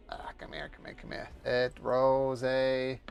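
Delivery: normal talking voice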